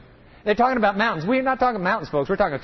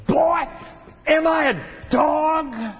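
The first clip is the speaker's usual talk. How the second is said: mock the boy, shouting down at him; giant's taunt; 8 semitones higher, slower than usual. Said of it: "the Philistine said to David".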